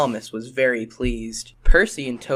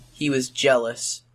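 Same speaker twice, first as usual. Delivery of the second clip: usual speaking voice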